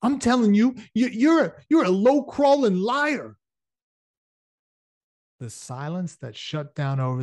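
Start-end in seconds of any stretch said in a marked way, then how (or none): 0.0-3.4 much higher, louder furious yell; worked up. Acted. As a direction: lose control and yell the insult. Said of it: "is getting worked up".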